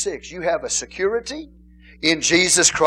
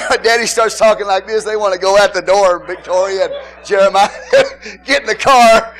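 loud laughing retelling; 3 semitones higher, 10 dB louder than usual. Retold or imitated.

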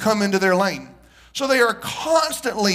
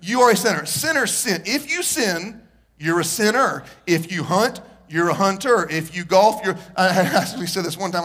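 normal talking voice

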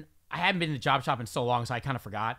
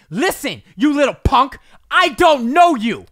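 loud, higher, slow furious scolding; angry scolding; 8 semitones higher, 14 dB louder, slower than usual. Retold or imitated. Woman at the top of the stairs scolding him, angry and loud. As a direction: jab a finger and tell him off. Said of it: "she put her finger in my face".

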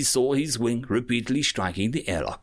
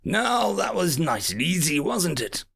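smug pirate; 7 semitones higher than usual. A narrator's reading. Pirate captain taunting.

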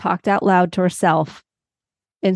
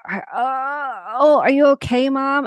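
put-on higher, worried little girl asking; concerned daughter; 6 semitones higher, slower than usual. Daughter asking her hurt mom if she's okay, higher and concerned. Daughter voiced in retold story.

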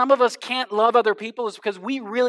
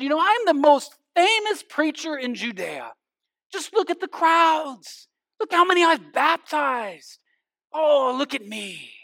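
boastful bragging; 7 semitones higher, 4 dB louder than usual. Pretend. John imagined boasting to the Pharisees about his fame, showing off. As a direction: puff up like a preacher admiring his own crowds. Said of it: "John could have gone to this extreme".

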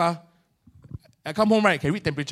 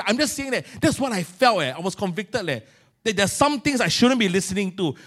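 ordinary talk

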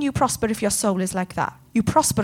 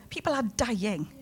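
hushed repeat; 2 semitones lower, 10 dB quieter than usual. Real, not acted. Preacher repeats the warning to the congregation, hushed. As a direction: let it fall almost to a hush.

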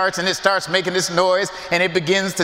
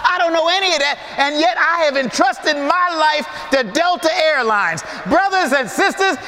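higher, building preacher's climax; full-force preaching; 9 semitones higher than usual. Acted. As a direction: preach it out to the congregation.

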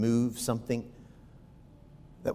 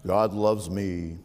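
slow, lower, syrupy pious voice; a hammed-up holier-than-thou brother; mock pious churchgoer; 3 semitones lower than usual. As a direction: mimic the smug saint in the pew. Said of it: "And all will say".

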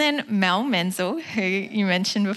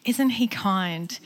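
usual speaking voice

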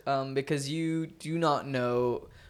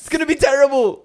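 bad Irish accent; 8 semitones higher, 10 dB louder, faster than usual. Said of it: "That's a terrible Irish accent".

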